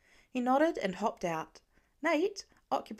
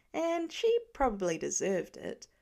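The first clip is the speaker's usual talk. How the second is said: plain reading voice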